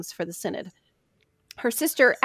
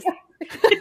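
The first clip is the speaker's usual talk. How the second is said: laughing; 9 semitones higher, 5 dB louder than usual. Real, not acted. Laughing promise to finish.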